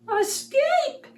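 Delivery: very high meowed word; meowing cat. A narrator's reading. Circus cat urging escape, high meow. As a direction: mew it like a cat. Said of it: "meowed the cat".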